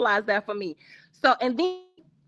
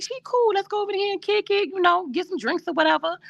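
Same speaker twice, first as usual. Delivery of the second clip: pitched well up, quick and breezy; high quoted voice; 9 semitones higher, faster than usual. Retold or imitated. Friend retelling her own invite, high and breezy. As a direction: toss it off high and fast, easy-going. Quoting her past self.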